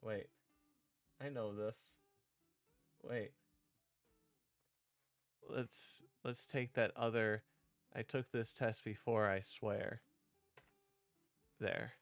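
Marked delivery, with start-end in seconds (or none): none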